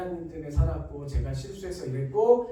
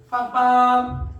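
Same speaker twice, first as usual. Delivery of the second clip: loud imitated horn blast; sharp loud beep beep; car horn honk; 3 semitones higher, 7 dB louder, much slower than usual. Father honks car horn.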